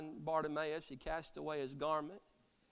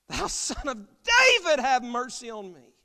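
crying out; 9 semitones higher, 12 dB louder than usual. Acted. Blind beggar crying out to Jesus over the crowd, loud plea. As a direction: cry it out at full voice, begging for mercy. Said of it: "He cried the more a great deal".

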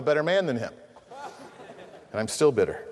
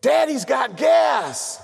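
put-on high, very slow child shout; loud toddler announcement; 6 semitones higher, 3 dB louder, much slower than usual. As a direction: announce it proudly like a little girl.

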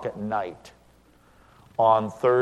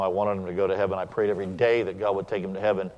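ordinary delivery

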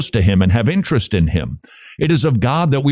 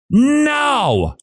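high, drawn-out "now"; preacher's soaring cry; emphatic exhortation. Acted. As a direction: launch it high as a rousing call. Acting out the gospel's exhortation.

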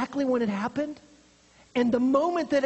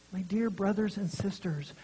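a hushed, close "My dear brothers and sisters"; whispered address; 4 semitones lower, 5 dB quieter than usual. Real, not acted. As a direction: whisper it softly to the congregation. Intimate address to congregation.

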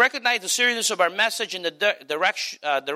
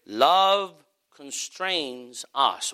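放慢庄重地说; slow solemn; much slower than usual. Acted. Closing line of the missionary quote.

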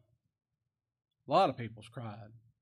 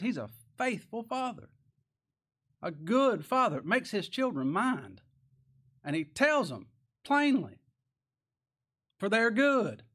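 emphatic preaching; 7 semitones higher, 5 dB louder than usual. Real, not acted. Preacher telling the church of a faithful father, emphatic preaching. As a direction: lean hard on each phrase with pauses between.

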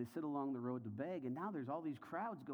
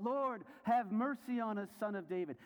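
crying out; 9 semitones higher, 5 dB louder than usual. Acted. Blind men calling to Jesus as he passes, crying out. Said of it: "they cried out".

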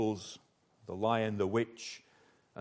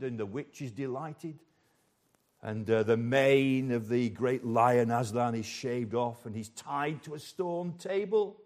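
ordinary delivery

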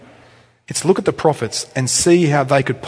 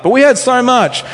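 higher, louder mock whine of complaining people; whinging crowd; 8 semitones higher, 4 dB louder than usual. Israelites whining to Moses about water, in a higher, louder mock whine. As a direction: whine like spoiled kids wanting Egypt.

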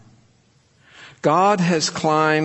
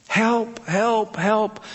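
mock screaming; 8 semitones higher, much slower than usual. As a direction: imitate a small boy screaming, held back. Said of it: "he started screaming".

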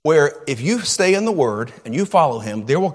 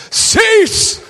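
one sudden booming word, much higher and louder; dad's sudden shout; 6 dB louder than usual. Retold or imitated. Dad silencing the noisy house with a sudden shout. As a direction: bark it out to freeze the room. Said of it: "he'd say".

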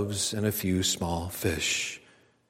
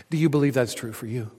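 normal talking voice

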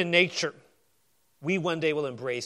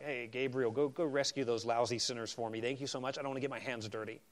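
softer, lower, careless mock voice of God; mock offhand God; 5 semitones lower, 9 dB quieter than usual. God lazily sending Gabriel to rescue sinners, softer mock voice. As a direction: toss the errand off like a bored boss. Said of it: "Be like".

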